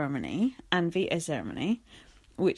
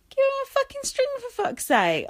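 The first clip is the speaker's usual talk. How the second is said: squealing, fed-up rant; exasperated outburst. Real, not acted. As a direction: shriek it in outrage. Ranting about the missing string.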